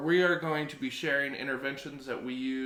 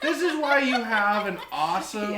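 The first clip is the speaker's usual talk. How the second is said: laughing; 8 semitones higher, 9 dB louder than usual. Real, not acted. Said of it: "the coffee's kicking in".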